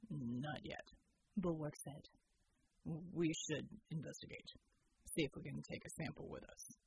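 low, steady soldier voice; deep measured military man; Bull's voice. Acted. Soldier Bull reporting to the team, low and steady.

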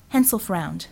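ordinary delivery, nothing marked

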